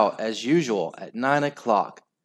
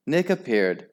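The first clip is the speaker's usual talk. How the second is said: ordinary delivery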